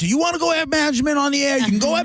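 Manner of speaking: high, fast mock rant from the riled-up manager; imitating an angry boss. Manager yelling at the host on the phone, high fast mock rant. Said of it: "He's like".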